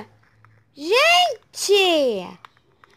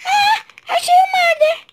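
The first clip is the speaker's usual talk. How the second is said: excited scream; 12 semitones higher, slower than usual. Real, not acted. Finds the murderer.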